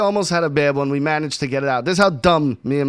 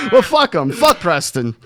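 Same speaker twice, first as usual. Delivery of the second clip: laughing; 6 semitones higher than usual. Real, not acted. Laughing about the kid.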